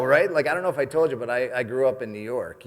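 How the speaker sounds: ordinary talk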